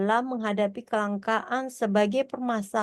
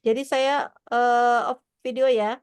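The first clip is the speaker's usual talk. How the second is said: corrupted glitchy audio; 2 semitones higher, 5 dB louder than usual. Real, not acted. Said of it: "nge-lag".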